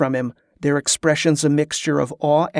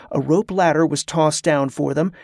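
ordinary delivery, nothing marked

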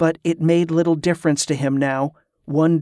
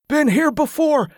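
high-pitched feverish cry; delirious outburst; 12 semitones higher than usual. A narrator's reading. Sick man repeating himself, staring at the gatehouse, high feverish cry. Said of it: "Tesca repeated".

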